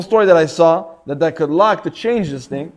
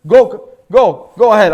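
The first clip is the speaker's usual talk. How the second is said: louder, friendly wave-through call; calling out go ahead; 2 semitones higher, 7 dB louder, slower than usual. Retold or imitated. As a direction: call out kindly to let the taxi pass. Waving the taxi in.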